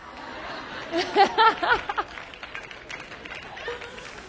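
long, hearty laugh at own punchline; real laughter from the pulpit. Real, not acted. Preacher laughs at own joke.

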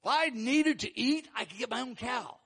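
mock the idea of needing their gift; total sarcasm. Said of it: "This total sarcasm right here".

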